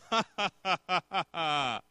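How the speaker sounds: mocking staccato laugh in God's role; scornful, put-on "ha ha ha"; malicious laugh. God looking down on the enemy, mocking laugh. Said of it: "God looks down and says".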